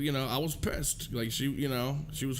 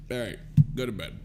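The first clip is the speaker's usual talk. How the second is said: resigned dismissal; 8 semitones lower, faster than usual. Retold or imitated. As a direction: wearily re-enact sending someone off to bed. Retells wearily sending her to bed.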